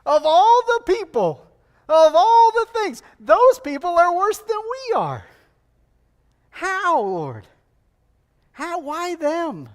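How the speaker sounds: high, loud, disbelieving outcry; the prophet aghast, protesting loudly to God; incredulous protest. Retold or imitated. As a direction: balk at God's choice in disbelief. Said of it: "Habakkuk responds probably appropriately, like".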